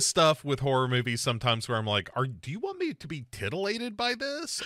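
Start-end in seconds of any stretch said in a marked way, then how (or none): 2.1-4.6 high, softer, puzzled pitch; mock bewildered question. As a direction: ask the filmmakers in disbelief. Puts own puzzlement to filmmakers.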